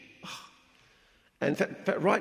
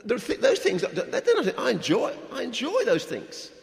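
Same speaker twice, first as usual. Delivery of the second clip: high, louder words through laughter; laughing off his own joke; 12 semitones higher, 6 dB louder than usual. Real, not acted. Joking about movies with his wife, laughing.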